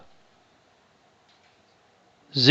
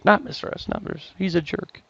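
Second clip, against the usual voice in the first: a playful whispered jab after a mock cheer; whispered joke; 15 dB quieter than usual. Teacher joking to the class about Mister S Numbers, whispered. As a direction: whisper it like a secret insult.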